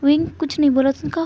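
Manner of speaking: usual speaking voice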